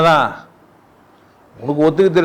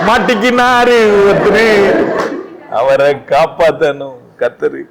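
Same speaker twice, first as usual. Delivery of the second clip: slow drawn-out telling; slower than usual. Acted. Slowed, drawn-out storytelling.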